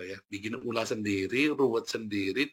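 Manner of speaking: usual speaking voice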